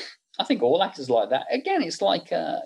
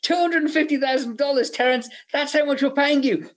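mock yelling, pitched very high; Gale Anne Hurd; 12 semitones higher than usual. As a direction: imitate a fed-up producer yelling at the actor. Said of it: "Gale Anne Hurd was yelling at him".

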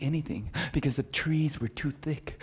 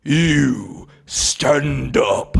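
slow, booming voice of a giant elk; giant alien booming voice; 8 dB louder, much slower than usual. Acted. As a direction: boom each word slowly like a huge beast. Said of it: "heard a deep voice say".

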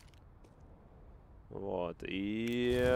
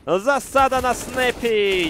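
drawn-out hyped shout; excited shout; 11 semitones higher, 9 dB louder, much slower than usual. Real, not acted. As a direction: yell it like a caster. Casting a big moment.

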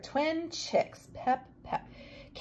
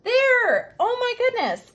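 excited; 12 semitones higher, 5 dB louder, slower than usual. Real, not acted. Teacher celebrating success.

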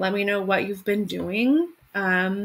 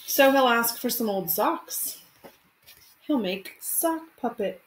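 gentle picture-book read-aloud; expressive storytime narration; reading to children. Acted. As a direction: read it like storytime for your class. Teacher reads picture book aloud.

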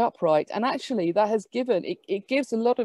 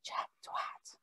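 soft unvoiced whisper; mischievous whisper in her ear; 17 dB quieter than usual. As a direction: lean in and breathe the name to make her laugh. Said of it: "They just whisper".

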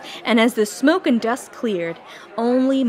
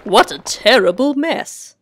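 slow, pompous bumbling gentleman; a pompous humbug, slow and tactless; 5 dB louder than usual. Acted. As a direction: drawl it out like a tactless old blowhard. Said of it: "observed the humbug".

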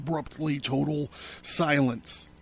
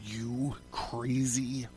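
slow, hushed whisper of disbelief; unnaturally low voice; 8 dB quieter, slower than usual. A narrator's reading. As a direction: whisper it slowly, word by word. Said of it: "his voice unnaturally low".

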